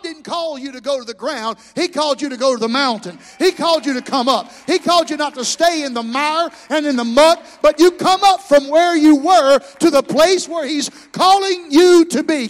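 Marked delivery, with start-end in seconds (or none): 1.7-12.5 louder and louder, rising to full force; building preaching shout. Real, not acted. Preacher building his congregation toward the mountain, shouting louder and louder.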